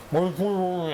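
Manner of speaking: high muffled voice behind a mask; muffled through a mask. Retold or imitated. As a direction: talk as if through a respirator mask. Voicing coworker behind mask.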